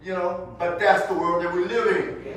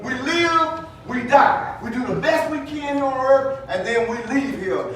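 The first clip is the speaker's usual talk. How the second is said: loud, higher preacher's build; preaching at full force; 6 semitones higher, 6 dB louder than usual. Acted. Preacher pressing the congregation toward Jesus, full-force preaching. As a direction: raise it to a fervent preaching peak.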